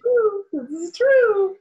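very high, drawn-out singsong agreement. Real, not acted. Agreeing with the others about taking any gig, high singsong. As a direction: sing it out high, stretching the last word.

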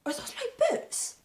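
high-pitched, drawn-out question; sudden squeak of disbelief; indignant surprise. Acted. Suddenly spots own boots on friend.

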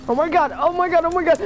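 high, frantic repeating; panicked. Real, not acted. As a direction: panic as the horde swarms. Overrun by zombies.

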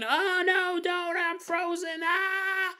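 very high, wailing mock panic; mock screaming and crying. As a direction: shriek as mom pulls you up. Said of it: "he's screaming and he's crying".

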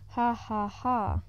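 ordinary delivery

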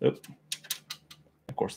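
ordinary talk

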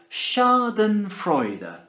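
"Schadenfreude" slow, SHAR-den-froy-da; saying Schadenfreude. Shown as a demonstration. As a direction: spell out "Schadenfreude" slowly. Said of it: "It's pronounced".

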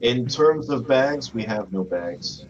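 slow, pompous official tone; mock formal. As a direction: drag it out like a bored clerk.

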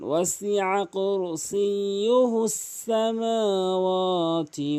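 slow melodic recitation; tajweed recitation; Quran recitation. Acted. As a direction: chant it slowly and evenly.

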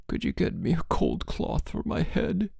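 put-on high, soft, pleading sick-boy voice. A narrator's reading. Sick boy begging his roommate for a cold cloth, pleading. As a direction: beg weakly from the pillow. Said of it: "he pleaded".

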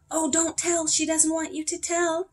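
high-pitched protest from the class; children calling out. A narrator's reading. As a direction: call out like kids stopping a secret. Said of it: "several children called".

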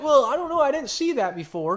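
high, loud mock dithering; unsure doubter. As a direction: waffle like someone who can't make up his mind. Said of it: "It's not something that's like".

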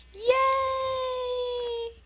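very high loud cheer; real joyful cheer. Real, not acted. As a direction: squeal yay with delight. Cheering making slime.

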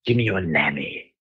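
low Cockney demand; Cockney accent. Retold or imitated. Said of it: "like, a Cockney accent".

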